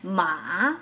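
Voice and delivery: "Ma" dropped low, then rising again; third-tone "Ma", low scoop and lift; dipping tone. Shown as a demonstration. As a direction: dip "Ma" like a letter V. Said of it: "goes back to mid-range".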